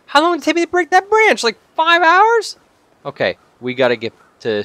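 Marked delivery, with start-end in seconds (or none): 0.1-2.6 loud, high-pitched outburst; exasperated yell. Real, not acted. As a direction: yell it in loud, incredulous complaint. Outraged at slow branch breaking.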